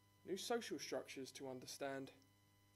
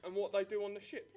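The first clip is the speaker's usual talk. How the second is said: ordinary delivery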